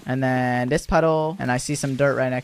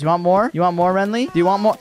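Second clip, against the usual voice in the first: eager repeated offer, slightly raised; a little excited; 2 semitones higher than usual. Real, not acted. Vendor offers customers more hot dogs, a little excited. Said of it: "I got a little excited there".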